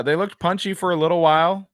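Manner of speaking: ordinary delivery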